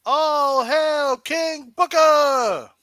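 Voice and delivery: slow, high, loud shout; mock proclamation. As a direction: proclaim it like a herald.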